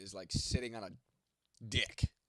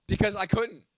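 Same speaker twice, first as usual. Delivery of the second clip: annoyed snap; 8 semitones higher, 13 dB louder than usual. Real, not acted. Answering a chat question, irritated.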